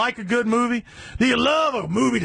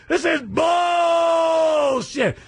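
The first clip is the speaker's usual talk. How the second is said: slow, stretched-out yell of disgust, higher; drawn-out shout; 8 semitones higher, much slower than usual. Real, not acted. Radio host ranting to listeners, stretched-out shout. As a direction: drag out every word and bellow it. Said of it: "I would like to say".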